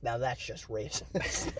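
deadpan quiet comeback. Retold or imitated. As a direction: mutter it like a wounded aside. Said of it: "He's like".

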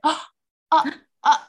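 high, loud choked-up "oh" in bursts; overcome gasps. Retold or imitated. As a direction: re-enact gasping, speechless with pride. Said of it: "I just went".

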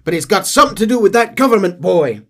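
loud angry father shouting. Retold or imitated. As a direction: yell it like a panicked father. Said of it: "He's shouting and screaming".

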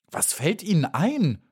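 a little bee crying out in outrage; angry cry. Acted. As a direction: Maya flares up, offended. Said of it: "rief Maya zornig".

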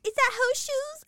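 put-on high, loud kid voice; little girl. Podcaster imitating the little girl asking again, high and childlike.

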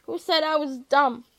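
loud indignant comeback; offended retort. A narrator's reading. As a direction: fire back, affronted. Offended retort in acted quarrel.

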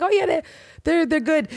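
high-pitched mock reassurance; bluffing reassurance. Fake doctor asked about test results, bluffing in a high voice. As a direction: imitate a fake doctor fibbing brightly, voice way up.

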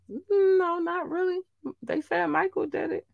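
very high, soft little voice; high-pitched aside. Acted. Host answering own question on the rumor, high-pitched. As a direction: lift it right up high and lighten it.

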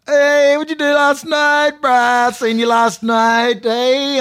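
put-on high, loud, slow teasing kid voice. Young lads teasing him about last night, high slow mocking.

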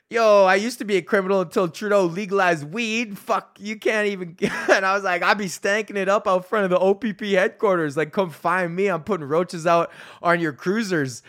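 teasing the cops without a care; carefree older self. Retold or imitated. Comedian joking to a room of police, cocky and carefree. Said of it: "thirty-three-year-old me was like".

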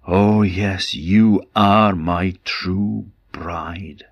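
slow whispered wonder; tender whisper. Acted. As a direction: breathe it to her gently. Prince recognises his true bride.

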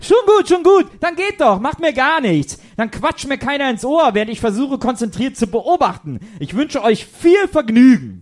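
high, loud offended huff; huffy sulk. Acted. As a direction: go on, leave, I don't care. Huffy sulking after being left.

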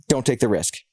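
normal talking voice

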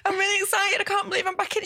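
high, teary and excited; tearful excitement. Retold or imitated. Telling mum on FaceTime about the audition, teary and high.

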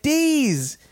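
high, drawn-out setup line; deadpan answer. Retold or imitated. Man answering the crowd, high innocent mock. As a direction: hold the pun with a high innocent tone. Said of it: "And I was like".

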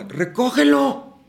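loud, slow, high command; urging actors to pick up the dropped prop; shout. Pretend. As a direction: bellow it out slowly. Urging to pick up fallen object.

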